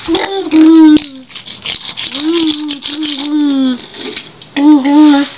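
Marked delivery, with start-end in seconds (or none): 0.1-1.3 shouted toy crash booms; loud boom noises. Pretend. Child playing crash noises. 4.6-5.3 shouted toy crash booms; loud boom noises. Pretend. Child playing crash noises.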